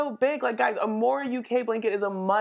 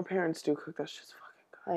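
hushed whisper; 3 semitones lower, 10 dB quieter than usual. Real, not acted. Co-host whispers about her parents' cooking, hushed. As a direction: whisper it like a secret about food. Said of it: "whispering about food".

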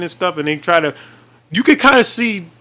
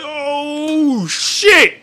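shocked exclamation; 9 semitones higher than usual. Retold or imitated. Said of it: "I was like".

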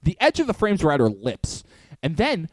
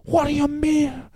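Sebastian Maniscalco; 7 semitones higher than usual. Acted. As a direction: drag out the baffled protest. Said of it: "They turn into Sebastian Maniscalco".

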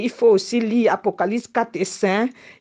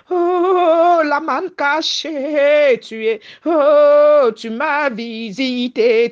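ecstatic worship; slower than usual. Real, not acted. Ecstatic worship, loud drawn oh. (louange extatique, lente, avec des « oh » criés)

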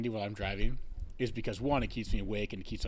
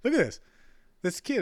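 excited; 11 semitones higher, 6 dB louder than usual. Real, not acted. Excited pointing out the kid.

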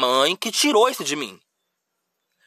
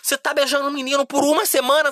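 much higher, incredulous imitation of dad; shocked dad; 8 semitones higher than usual. Dad shocked to hear his teen kissed a boy for a week, incredulous and high. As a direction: mimic dad's outraged disbelief, voice way up.

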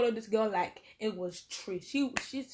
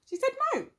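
much higher, indignant; indignant protest. Retold or imitated. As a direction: squeak it out in disbelief. Protesting to head teacher.

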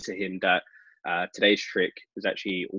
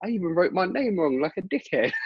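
high-pitched imitation of the guy; pitched-up mock embarrassment; self-mocking punter; 10 semitones higher than usual. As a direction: squeak out the self-insult. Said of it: "it's like".

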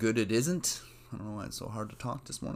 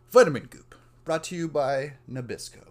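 loud, raised announcer sign-off; mock ad tagline; 5 semitones higher, 10 dB louder than usual. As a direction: punch it out like a parody sponsor read. Mock ad tag closing the skit.

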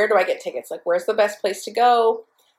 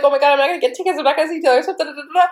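high, frantic run of worries; retold freak-out; 6 semitones higher, 4 dB louder, much faster than usual. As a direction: act out spiralling panic, higher and louder. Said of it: "I was freaking out".

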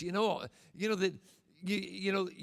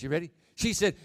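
ordinary talk

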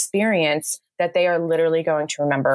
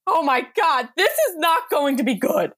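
panic quote; 9 semitones higher than usual. Retold or imitated. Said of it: "I'm like".

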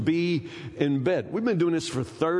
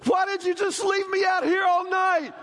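high, louder indignant shout; angry wife; 12 semitones higher, 3 dB louder than usual. Retold or imitated. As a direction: accuse him like a wronged wife. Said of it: "she came in the bedroom so angry".

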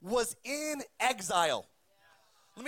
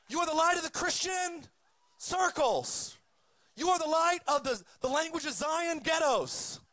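mocking shout; 5 semitones higher than usual. Preacher ridiculing the misquoted verse to the crowd, shouting. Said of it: "No, he says".

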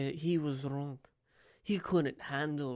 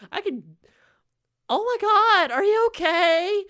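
very high, louder mock girl squeal; squeaky girl voice; 11 dB louder than usual. Player testing a very high girl voice. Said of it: "maybe I need to try to".

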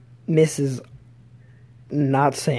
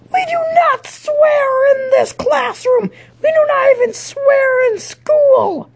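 slow, piercing falsetto shriek; hammed-up screeched telling-off, every word drawn out; mock outraged para; 5 dB louder than usual. Para scolding student for swearing, mock outraged shriek.

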